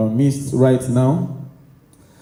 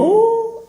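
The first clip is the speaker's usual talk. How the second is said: very high yelp of "oh"; sudden high excited cry; demo crowd scream. Shown as a demonstration. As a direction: squeal "oh" like a cheering crowd. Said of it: "feel free to scream".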